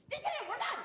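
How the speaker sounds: high, loud exasperated yell; fed-up partner yelling to get it over with; frustrated shout. Acted. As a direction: blurt it out fed up.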